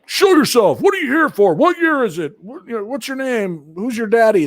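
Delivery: high, loud mock ghost-hunter challenge; mocking investigators. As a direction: ham up pushy ghost hunters calling out. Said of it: "but they were all".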